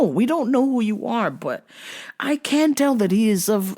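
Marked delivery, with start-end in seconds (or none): none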